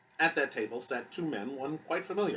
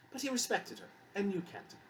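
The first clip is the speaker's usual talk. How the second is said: high-pitched, hasty reassurance; quick addition; 10 semitones higher, 5 dB quieter than usual. A narrator's reading. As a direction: add it quickly to soften the remark. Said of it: "Susan added quickly".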